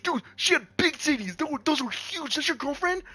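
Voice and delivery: put-on high, amazed boyish voice; a kid who can't believe what he saw; awed kid voice. Cousin gushing to him about the girlfriend, high awed voice.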